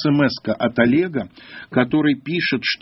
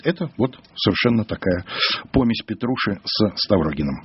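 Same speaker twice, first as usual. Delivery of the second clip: normal talking voice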